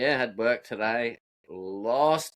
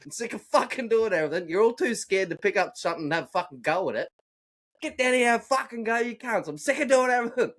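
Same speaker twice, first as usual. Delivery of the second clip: replaying a furious blow-up, pitched up; retold rant; 9 semitones higher than usual. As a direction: vent at the crew who won't have a go. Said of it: "I lost my shit".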